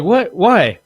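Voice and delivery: imitating the buddy shocked by the threat; startled. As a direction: act the friend caught off guard. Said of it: "And he's like".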